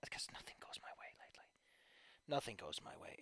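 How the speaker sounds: low dejected whisper. Real, not acted. Streamer whose writing is stonewalled, dejected whisper. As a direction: drop to a defeated murmur. Despondent venting about stalled writing.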